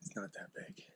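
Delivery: hushed hunter whisper. Real, not acted. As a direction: whisper so the deer can't hear. Whispering over a deer track.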